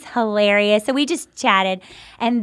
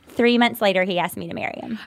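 ordinary delivery

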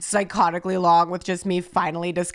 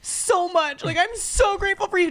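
mock crying; 12 semitones higher than usual. Texting loved ones after a sad show, teary gush. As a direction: gush through fake tears like a wreck. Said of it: "crying so hard I started messaging".

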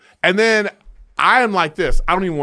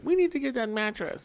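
imitating wife; 10 semitones higher, 8 dB quieter than usual. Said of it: "she was like".

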